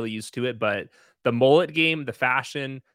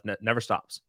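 ordinary delivery

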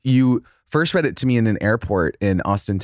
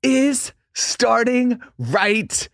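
high, slow hammed-up announcer; mock announcer; 12 semitones higher, much slower than usual. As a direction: stretch it out like a hype announcer.

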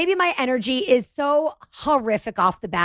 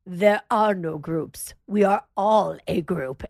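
mock sage stranger; 7 semitones lower, slower than usual. Stranger quoted in story.

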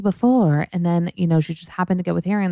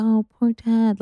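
higher, mock sympathetic coo over poor Ted; mock pity; 7 semitones higher, slower than usual. Imitating a guy pitying Ted, mock sympathy. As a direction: fake pitying sigh in a higher voice. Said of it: "And the guy's like".

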